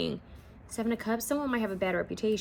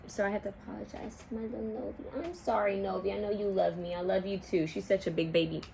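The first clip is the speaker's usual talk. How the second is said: softer, cooing talk to a pet; 5 dB quieter than usual. Real, not acted. Owner softly apologizes to her little dog Novi. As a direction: coo your apology to the dog.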